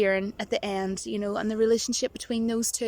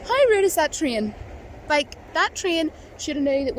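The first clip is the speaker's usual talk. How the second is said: mock outrage; 6 semitones higher than usual. Complaining the train ruined the vlog, mock outrage. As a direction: scold the train playfully.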